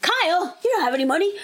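high and exasperated; talking back to the boyfriend, mock scolding. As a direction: scold him like a broke boyfriend. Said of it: "I'm like".

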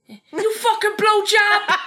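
loud, high, drawn-out Jersey yell; over-the-top Jersey shout, stretched slowly; Jersey accent. Acted. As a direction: holler it in a hammed-up Jersey accent. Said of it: "can't do any more in the Jersey accent".

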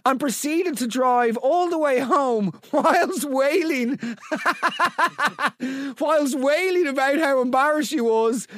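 high, laughing read-out; cracking up. Real, not acted. Host reading out a mortifying car story, cracking up. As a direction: struggle through the story while laughing.

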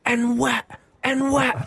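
high, mock-drunk yell of a man in the road; drunk man shouting. Mimicking a drunk stranger yelling at his car, high mock yell. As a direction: ham up a tipsy stranger yelling in the street. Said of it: "starts shouting".

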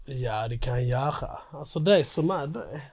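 mock Scanian dialect; skånska. Obliging a friend's request, in put-on skånska. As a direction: slip into southern Swedish skånska. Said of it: "vad heter det, skånska?".

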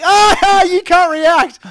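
very high, loud laughing yell; hammed-up squeal of excitement; excited retold yell. Bandmate teasing friend stuck in a restaurant, excited yell.

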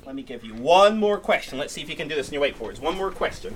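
high classroom voice, rising emphasis; teacher to class. Real, not acted. Teacher setting the class a question.

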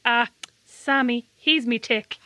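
ordinary talk

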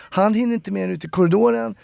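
ordinary talk, nothing marked

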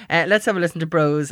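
ordinary delivery

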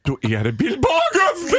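shouted high and slow in disbelief; shocked discovery. Real, not acted. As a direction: blurt out the name in sudden shock. Recognizing the naked man.

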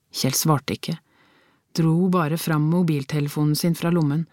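plain reading voice

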